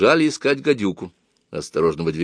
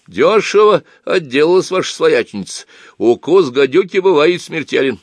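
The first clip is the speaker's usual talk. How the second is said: high-voiced, loud old man's remark; acted matter-of-fact old fellow; cool-headed old man; 8 semitones higher, 5 dB louder than usual. A narrator's reading. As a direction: answer down from the top bunk, unperturbed. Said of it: "хладнокровно отозвался он".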